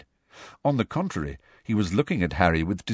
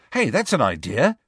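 higher, brighter burst of interest; excited; 6 semitones higher, 4 dB louder than usual. A narrator's reading. Boy jumping at a meeting-place idea, excited. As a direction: seize on the suggestion excitedly. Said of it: "said Ron excitedly".

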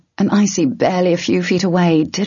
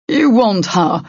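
cold challenge, pitched up; 5 semitones higher, 3 dB louder, slower than usual. A narrator's reading. Hessler standing over Tern facing the ogre, cold. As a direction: stand guard with a cold warning. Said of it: "Hessler said coldly".

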